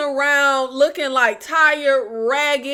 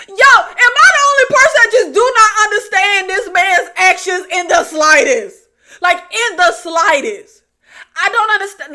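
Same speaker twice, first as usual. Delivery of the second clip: louder, higher, exasperated disbelief; incredulous rant; 4 semitones higher, 6 dB louder than usual. Real, not acted. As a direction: demand the audience agree with you. Ranting about the character's baffling actions.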